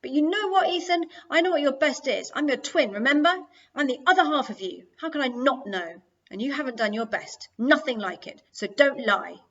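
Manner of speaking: heated accusation at full voice; angry retort. A narrator's reading. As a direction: hammer it home to your twin, furious. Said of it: "getting red in the face with anger".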